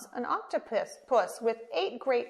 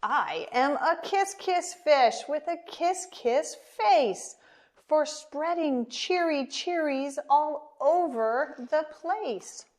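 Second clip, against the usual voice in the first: higher, louder happy sing-song on "kiss"; cheery refrain; 6 semitones higher, 4 dB louder than usual. Acted. Kiss fish chanting his happy refrain. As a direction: beam through the refrain.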